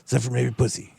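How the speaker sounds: mock old guy, low and soft; low, quiet aside in his voice; low old guy's voice. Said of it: "He's like".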